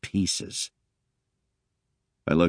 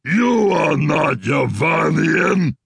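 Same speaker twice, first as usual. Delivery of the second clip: slow, loud, mangled-English snarl; big alien creature shouting back; 12 semitones higher, 7 dB louder, much slower than usual. Acted. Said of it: "he snarled back at me in typically Yavanian mangled English".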